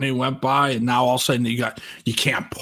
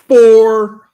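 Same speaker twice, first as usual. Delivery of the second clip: "Four." yelled much louder and higher; 8 semitones higher, 11 dB louder than usual. Retold or imitated. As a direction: belt out "Four." across the restaurant. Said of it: "I just yelled loud".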